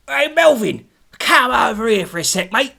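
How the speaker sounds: loud call, high and bright over the room; calling across the pub. Acted. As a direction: shout him over from the bar.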